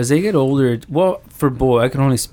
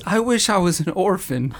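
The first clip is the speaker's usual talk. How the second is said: a lightly voiced sulking boy, pitched up; sulky kid voice; 7 semitones higher than usual. Mom imitates son's sulky jab during an argument, lightly voiced higher. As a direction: play the hurt kid tossing out a jab, mildly mocked. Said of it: "he says".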